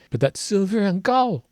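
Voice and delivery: high, drawn-out Southern American twang; Southern accent. Retold or imitated. Musician mimicking the singers' line, in a Southern accent. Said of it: "They put on a Southern accent".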